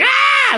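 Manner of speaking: very high, held shriek; piercing mock scream on one note; imitated scared scream. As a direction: break into a sudden high yell. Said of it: "that dude that's always going".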